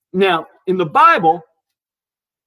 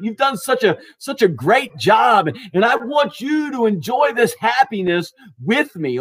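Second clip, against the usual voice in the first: higher-pitched, celebratory congratulation; delighted praise; 5 semitones higher than usual. Acted. Master rewarding his faithful servant, delighted. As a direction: heap praise like a thrilled boss.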